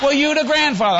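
loud, high nagging relative; high scolding voice. Retold or imitated. Family member scolding.

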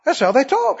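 ordinary talk, nothing marked

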